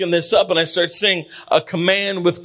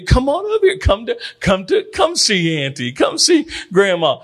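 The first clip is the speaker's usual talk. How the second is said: coaxing grandma or auntie; 3 semitones higher than usual. Acted. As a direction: coax a shy child over to you. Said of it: "grandmas and grandpas or aunts and uncles".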